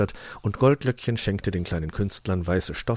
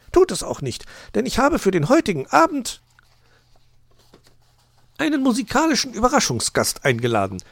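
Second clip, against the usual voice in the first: high, brisk magpie voice; high, self-assured bird lady; Frau Elster; 5 dB louder than usual. A narrator's reading. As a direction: declare it grandly in a high voice.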